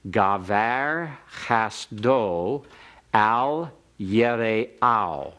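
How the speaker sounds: slow, drawn-out Hebrew for the class to repeat; slow model reading. Shown as a demonstration. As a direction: read it slowly for them to echo. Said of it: "let me read it one more time, and then you can repeat after me".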